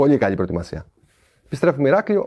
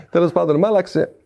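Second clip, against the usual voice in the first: ordinary delivery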